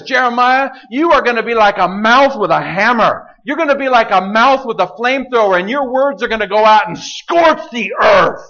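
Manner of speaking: God thundering his charge to the prophet; booming voice of God. Acted. God charging Jeremiah, in a high booming proclamation. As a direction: proclaim it high and loud like a fiery commission.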